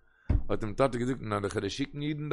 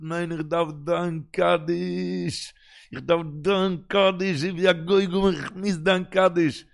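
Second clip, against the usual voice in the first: slow, drawn-out higher-pitched pleading; insistent plea; 5 semitones higher, 5 dB louder, slower than usual. Acted. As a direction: stretch each word in an imploring chant. Father's insistent plea for Kaddish. (לאנגזאם, בעטנדיק און אויסגעצויגן)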